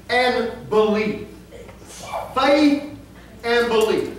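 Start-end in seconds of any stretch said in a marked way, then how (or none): 2.3-2.8 Faith lifted high and loud; stressed word. Shown as a demonstration. Preacher hammering the key word Faith, high and loud. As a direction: punch Faith out on its own. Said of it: "repeats key word".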